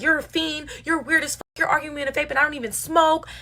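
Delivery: high-pitched reenacted comeback; arguing back. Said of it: "So I'm arguing back".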